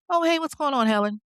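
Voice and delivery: breezy, offhand greeting pitched high; lightly acted nonchalant hello; casual. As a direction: play it cool, like nothing happened. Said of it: "he's casual with her".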